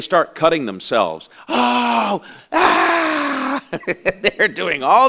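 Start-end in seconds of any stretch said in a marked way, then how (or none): 1.5-3.6 very high, drawn-out mock wail; hammed-up "oh" rising into a shrill "ah"; mock agonized cries. Prophets cutting themselves for Baal, mock agonized wailing.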